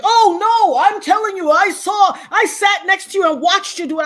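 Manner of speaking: high, loud insistent accuser; mock yelling. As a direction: imitate a woman hollering her claim down the phone. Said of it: "she's on the phone yelling".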